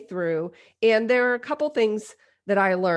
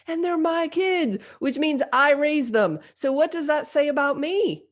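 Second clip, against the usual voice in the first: panicked self-questioning; 6 semitones higher than usual. Retold or imitated. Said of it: "I'm like".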